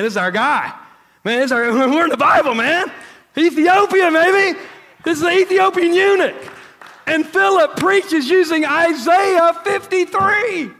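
shouting high with laughing joy; excited. Real, not acted. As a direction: burst out, thrilled and loud. Thrilled about Ethiopians in the Bible.

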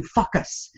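"focus" said as "fuck us"; short u in place of the long o, "fuck us"; mispronounced "focus". Retold or imitated. Imitating father's one imperfect word, "focus" said as "fuck us". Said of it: "all these words perfect except for one".

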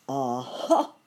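loud storybook alligator; a hint of menacing alligator; exclaiming villain creature. A narrator's reading. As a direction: pounce on it with mild villainous glee. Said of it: "exclaimed the unpleasant creature".

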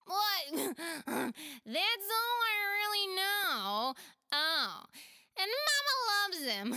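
put-on high, loud kid with a laugh; a playful kid offering help; giggly child voice. Pela offers to help friends, giggly child voice.

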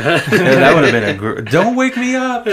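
high, slow, laughing punchline; laughing joke. Pretend. To friends laughing at his dream, a high slow laughing punchline. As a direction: stretch out the gag while cracking up.

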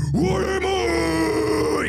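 high, drawn-out horrified question; shocked cry. Acted. Demon bunny seeing its reflection in blood, horrified. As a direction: stretch it out in horror.

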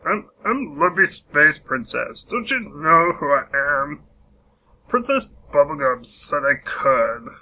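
high, bratty cartoon princess voice; whiny, entitled valley-girl princess; Lumpy Space Princess. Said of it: "I do a spot-on lumpy space princess impression".

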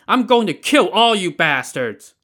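high, loud raving yell; furious threat. Acted. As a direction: rave it like a madman. Madman threatens the soldiers.

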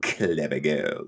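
put-on low and slow "Clever Girls" with a laugh; mock serious "Clever Girls"; laughing imitation. Shown as a demonstration. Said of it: "I just want us to say it like".

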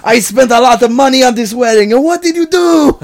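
high melodramatic wail, climbing to the end; hammed-up outraged shout. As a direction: protest like an overwrought father in parody. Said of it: "Then he shouts".

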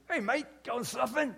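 imitating a friendly Australian bloke; casual Australian accent hint; Australian surfer. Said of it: "this, uh, Australian guy picks me up".

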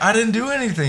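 high, scared protest of innocence; panicked pleading. Retold or imitated. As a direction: squeak it out in a panic. Retold arrest, pleading innocence.

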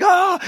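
high, loud yelp of surprise; a hammed-up happy shriek; mock joyful scream. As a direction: yelp as the cold Gatorade hits. Gatorade dumped on [name]'s head.

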